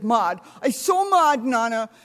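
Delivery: put-on a cross toddler correcting the quote; grandson's mad voice. Grandma redoing her grandson's angry complaint. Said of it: "No, he says".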